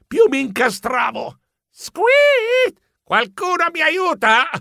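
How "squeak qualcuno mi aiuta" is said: much higher squeak then plea for help; frantic squeak. Acted. Geronimo tangled in the net, squeaking for help. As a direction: squeak like a trapped mouse.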